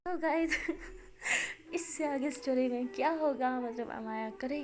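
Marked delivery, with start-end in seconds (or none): 0.5-1.7 light airy chuckle. Real, not acted. Host laughs at cliffhanger.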